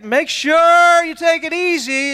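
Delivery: high, slowly stretched, sugary concern; mock chipper. Chipper hiker advises the winded dad, mock chipper. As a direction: overdo the helpful cheer, drawing it out. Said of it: "he shows up all chipper".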